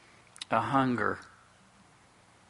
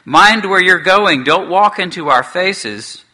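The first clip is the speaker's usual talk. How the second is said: higher, louder surly dwarf complaint; 5 semitones higher, 7 dB louder than usual. Acted. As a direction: grumble at the intruders, loud and cross. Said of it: "said one of them in a surly voice".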